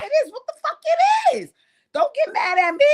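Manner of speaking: very high, loud exclamation; exasperated outburst. Pretend. Host telling Biggie not to get mad, exasperated. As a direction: throw it out in squealing exasperation.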